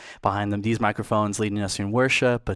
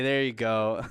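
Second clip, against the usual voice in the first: ordinary delivery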